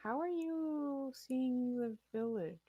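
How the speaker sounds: slow, high-pitched bewildered question; puzzled. Real, not acted. Game master baffled that player sees the village, puzzled. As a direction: stretch it out in baffled disbelief.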